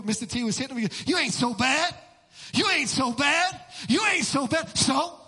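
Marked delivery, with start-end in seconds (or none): 1.1-4.8 loud, high taunt from a battered fighter; imitated boxer's shouted taunt; Rocky. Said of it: "Remember Rocky in the movie?". 4.8-5.2 loud, high challenge; cocky, raised-voice retort; defiant "so?". Shown as a demonstration. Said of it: "Devil throws something".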